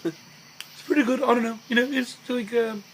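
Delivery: very high-pitched Liverpudlian impression; pretentious Liverpudlian, way up high. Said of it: "I could do a pretty good impression of [name]".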